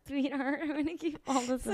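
high, quiet mimicry; mocking echo. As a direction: parrot it back softly in a high, teasing voice. Mocking echo of the sweetheart line.

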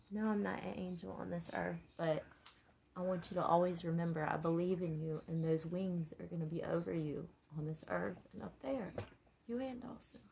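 usual speaking voice

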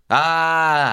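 long, loud, high "ah"; loud demo holler; nonverbal yell. Shown as a demonstration. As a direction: let out a sustained wordless yell. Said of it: "What if you just yell? Just nonverbal".

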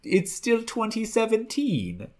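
put-on high, drawn-out joke Russian accent; mock Russian accent. Co-host topping the Russia joke, mock Russian accent.

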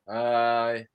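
low, soft mock telesales pitch; listless cold caller. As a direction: imitate a caller with no energy.

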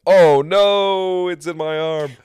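high, loud shocked cry. Retold or imitated. Wrestler retelling finding a hole in his arm, shocked high cry. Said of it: "And I went".

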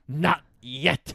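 prim, fussy little bird; Zazu. Retold or imitated. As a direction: scold it like a fussy butler. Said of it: "Zazu goes".

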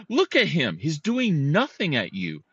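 high, indignant retort; retold anger. Dog owner arguing back at the man with the stick, retold anger. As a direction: re-enact an exasperated comeback. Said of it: "I was red".

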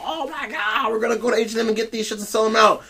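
high, soft, fast airhead voice; mock a giddy shopper, quick and breathy-high. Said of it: "mock shopper voice".

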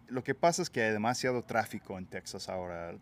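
usual speaking voice

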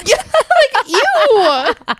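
high, laughing ew. Real, not acted. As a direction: squeal ew through laughter. Laughing in disgust.

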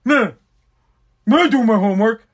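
a light mock voice of a protesting kid; imitating his son. Father mocks his son denying the schoolwork, light mock kid voice. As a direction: brush it off like a kid who swears he did it. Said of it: "My son will be like".

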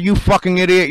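shouted high and hard; loud, high shout of insult; retold yell. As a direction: yell it right at the cop. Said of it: "I said".

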